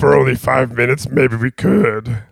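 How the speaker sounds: very slow puppet voice sliding from Yoda toward Fozzie Bear; Yoda drifting to Fozzie. Pretend. Host keeps Yoda going but drifts Muppet-ward. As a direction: let Yoda slip into another Muppet. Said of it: "you're morphing into other Muppets".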